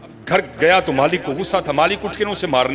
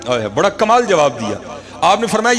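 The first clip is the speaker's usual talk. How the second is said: ordinary talk, nothing marked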